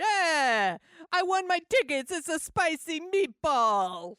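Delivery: put-on high, loud, hammy Italian accent. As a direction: belt it out like a cartoon Italian. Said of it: "has quite the Italian accent".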